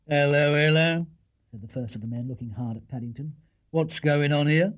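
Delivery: a constable taking charge; policeman. Acted. Policeman questioning the bear at night.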